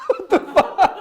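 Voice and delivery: real laugh spilling into the words. Real, not acted. Mocking the TV-laugh ick.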